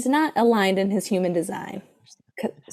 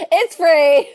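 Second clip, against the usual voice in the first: laughing it out loud; 6 semitones higher, 9 dB louder than usual. Real, not acted. Cohost laughing at the twenty dollar joke. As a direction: giggle through the words. Laughing while joking about price.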